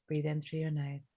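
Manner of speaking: soft, slow breath count, fading to a whisper. Shown as a demonstration. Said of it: "Let's go. I'm gonna count your breath".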